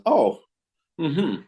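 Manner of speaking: high "oh", then a short hum; imitated approving murmur. Goodman checking his watch at the door, imitated murmur. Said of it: "Just went like that".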